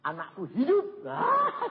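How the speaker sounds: loud, rising cry of joy; father hearing his child lives, shouting; joyful shout. Retold or imitated. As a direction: burst out with relief.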